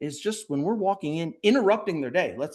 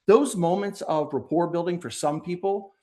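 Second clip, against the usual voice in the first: ordinary talk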